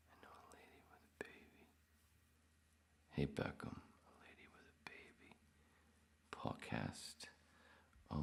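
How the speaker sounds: fast, breathless whisper. Real, not acted. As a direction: hush it out quickly. Keeps whispering the comment.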